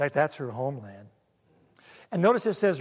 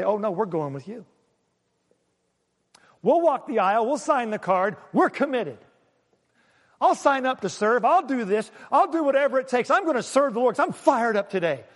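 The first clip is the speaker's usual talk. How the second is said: mock fired up; 4 semitones higher than usual. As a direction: play the daughters swearing they're all in. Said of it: "They're both fired up right now".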